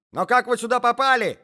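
high, loud startled cry; a lady shrieking at an intruder; lady cries out. A narrator's reading. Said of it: "закричала дама".

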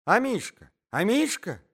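high, loud lady's cry. A narrator's reading. Lady calling her dog Амишка, crying out. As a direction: call out for the little dog in alarm. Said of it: "закричала дама".

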